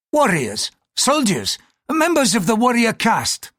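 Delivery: irritated, pitch climbing with each phrase; increasingly testy, high-pitched priest; growing irritation. A narrator's reading. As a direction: explain with patience running out. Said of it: "with growing irritation".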